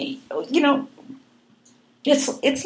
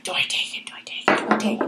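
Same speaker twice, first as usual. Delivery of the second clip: whispering to herself, unsure; whispered; 7 dB quieter, faster than usual. Retold or imitated. Patient whispering to herself over the counselor's box. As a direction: hush it, hesitating over the box. Retold hesitation at therapy.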